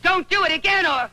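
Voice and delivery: sharp high outburst; furious warning. Acted. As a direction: hurl the warning at him. She warns the general furiously.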